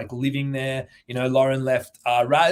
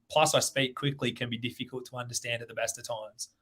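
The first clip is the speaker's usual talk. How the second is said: normal talking voice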